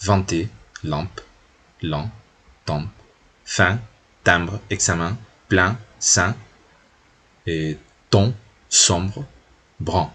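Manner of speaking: slow, careful French nasal vowels in each word; teacher modeling French nasals word by word. Shown as a demonstration. Teacher modeling nasal words for Spanish-speaking students, careful French nasals. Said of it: "grafías que van a corresponder a las nasales".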